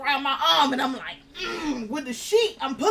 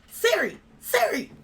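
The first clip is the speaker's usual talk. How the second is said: calling to Siri. Retold or imitated. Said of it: "I'm sitting there and I'm like".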